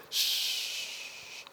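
hushed shh before a prophecy; mock prophetic hush. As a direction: shush them like a prophet. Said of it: "I said".

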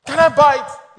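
eager pleading. Acted. Wife asking her man on the phone, eagerly pleading. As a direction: burst out with the big ask. Woman begging man to pay.

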